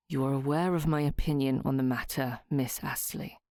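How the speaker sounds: a grudging man stating his opinion; Northcott, cold. A narrator's reading.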